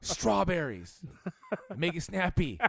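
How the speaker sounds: high, slow, laughing command; hammed-up snooty order; bossy demand. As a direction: order it like a pompous boss. Friend demanding strawberries.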